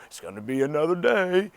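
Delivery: gloomy donkey resigned to the week; Eeyore. Acted. Walking into the week like Eeyore, gloomy. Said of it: "walk to the week like Eeyore".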